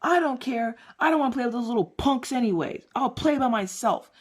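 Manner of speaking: put-on boy pouting to his sister about the other kids; sulky little boy. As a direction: sulk like a kid who got caught.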